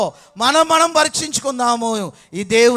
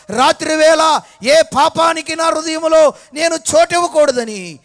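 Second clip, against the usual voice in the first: louder, higher impassioned prayer; fervent preaching; 5 semitones higher, 6 dB louder than usual. Acted. Preacher voicing a vow of purity, loud and fervent. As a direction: pour it out like a vow.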